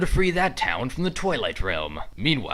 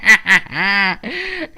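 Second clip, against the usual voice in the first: higher, louder villain cackle; gloating laugh; 6 semitones higher, 8 dB louder than usual. Acted. Villain laughs at the cliffhanger, gleeful cackle. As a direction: cackle with glee.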